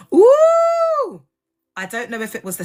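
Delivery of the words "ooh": long, piercingly high, loud "ooh"; high whoop. Real, not acted. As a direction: cry out on one sustained high note. Exclaims after pause in rant.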